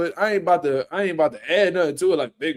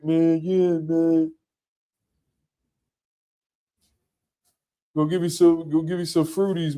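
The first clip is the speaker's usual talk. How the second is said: mock street guy. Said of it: "Ain't nobody from the street ever be like".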